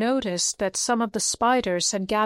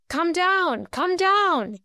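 shouted call; 9 semitones higher, slower than usual. A narrator's reading. Bilbo calling the dwarves down from the branch, shouting. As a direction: call up to someone far off. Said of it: "he shouted to the dwarves".

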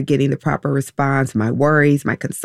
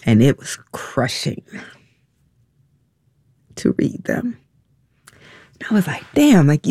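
overcome, halting between long pauses; real choked-up distress; 6 semitones higher than usual. Real, not acted. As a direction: voice giving way, high and strained. Recalls crushing emails, overcome.

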